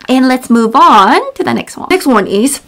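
ordinary talk, nothing marked

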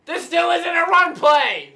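real gamer fury, word by word; furious shout. Real, not acted. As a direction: bellow it at the screen. Furious at the game.

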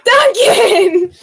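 loud, high cry of surprise; excited shout. Real, not acted. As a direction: call the name out in amazement. Shocked by a surprise donation.

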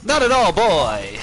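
put-on old-timey voice. Playful put-on reply.

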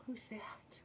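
hushed whisper to the cat. Real, not acted. Playing with the cat.

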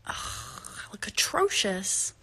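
breathy whispered groan at herself. Real, not acted.

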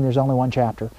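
ordinary talk

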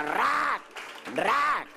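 high, sharp "rac" for each rip; imitated ripping noise. Beautician rips strip after strip, imitated high rip. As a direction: mimic wax strips torn off, pitched high.

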